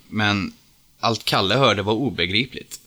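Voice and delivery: usual speaking voice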